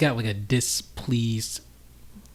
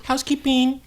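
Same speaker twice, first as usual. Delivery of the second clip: mock housekeeping call; 5 dB louder than usual.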